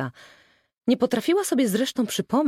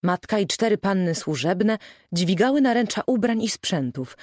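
usual speaking voice

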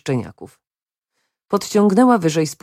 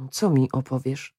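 small, subdued worried question; mild alarm, kept quiet; frightened; 4 semitones lower, 5 dB quieter than usual. A narrator's reading. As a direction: ask it low and softly, a touch afraid. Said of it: "przestraszył się Joachim".

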